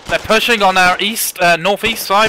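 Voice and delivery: urgent callout. Real, not acted. Warning team of enemy.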